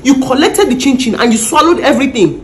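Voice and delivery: loud furious scolding; angry scolding. Acted. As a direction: tear into her, shouting. Ma scolds house girl.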